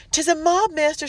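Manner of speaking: high, loud, panicked servant's cry; frightened cry. A narrator's reading. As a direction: cry out the alarm in terror. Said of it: "she cried".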